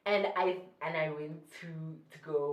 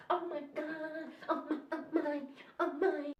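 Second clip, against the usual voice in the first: acting crazy; 9 semitones higher, 3 dB quieter than usual. Acting out going crazy in shower.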